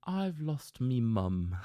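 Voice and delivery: put-on a playful British accent, drawn out. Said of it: "Should I do a British accent?".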